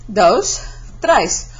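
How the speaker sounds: ordinary delivery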